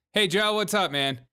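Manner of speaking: ordinary talk, nothing marked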